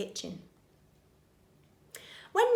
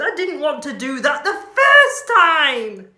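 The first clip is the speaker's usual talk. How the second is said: laughing; 6 semitones higher, 13 dB louder than usual. Acted. Character quote with a laugh.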